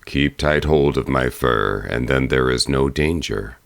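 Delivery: low, steady bear voice; calm her as a big bear; reassuring bear. A narrator's reading. Bear telling the girl to hold on, low and reassuring.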